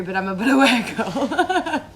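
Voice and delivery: playful line breaking into laughter; tease, then dissolve into a laugh. Real, not acted. Teasing apology breaking into laughter.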